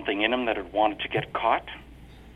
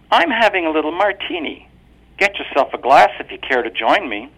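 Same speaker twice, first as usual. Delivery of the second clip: higher, composed wife's voice, each word crisp; clear and distinct; 7 semitones higher, 7 dB louder than usual. A narrator's reading. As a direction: speak crisply, fully in control. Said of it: "she spoke clearly, distinctly".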